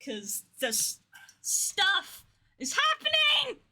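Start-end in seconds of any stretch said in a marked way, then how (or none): 1.4-3.6 high, loud, slow squeal; excited squeal. Real, not acted. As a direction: burst out with glee. Excited about delivered plush.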